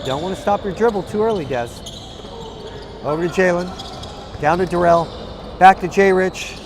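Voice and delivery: ordinary talk, nothing marked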